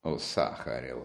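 low, slow, soft murmur; knowing remark under the breath; sly mutter. A narrator's reading. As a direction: mutter it with a roguish smirk. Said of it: "пробормотала Настасья, плутовски усмехаясь".

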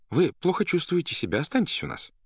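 high-pitched, persuasive plea; coaxing doctor. Acted. Doctor urges sick patient to stay, coaxing. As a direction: coax in a lifted, unforced voice.

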